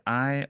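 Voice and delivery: "I" lifted high and loud; stressed I. Shown as a demonstration. Explaining the stress to the class, lifting I. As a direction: pop the word "I" up. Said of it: "I emphasized".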